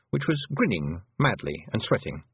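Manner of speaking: ordinary talk, nothing marked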